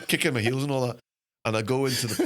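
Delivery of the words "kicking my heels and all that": ordinary delivery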